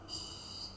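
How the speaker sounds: soft voiceless shush; hushing shush. Real, not acted. Teacher quieting children so they listen, soft shush.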